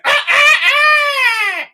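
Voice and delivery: very high crowing cry; imitated rooster crow. Imitating the rooster at four in the morning, crowing.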